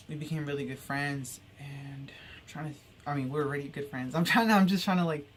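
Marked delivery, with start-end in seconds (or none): 1.6-2.8 slow unvoiced whisper, trailing off; whispered aside. Real, not acted. Aside while rushing the story. 4.1-5.3 higher and louder, bursting out; flustered outburst. Real, not acted. Flustered at rushing the story.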